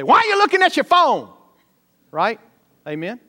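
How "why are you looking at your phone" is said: loud, high rebuke from the pulpit; mock call-out. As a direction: bark it at someone on their phone. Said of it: "I might say".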